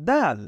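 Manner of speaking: দাল said high and loud as a clear letter name; letter name dal, high and loud. Shown as a demonstration. Teacher naming the letter দাল for students, high and loud. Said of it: "এটি হলো".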